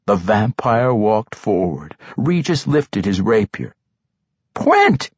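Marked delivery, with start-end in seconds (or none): 4.5-5.1 very high-pitched call of the name; desperate cry. A narrator's reading. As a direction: cry out to stop an attacker. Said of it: "he cried".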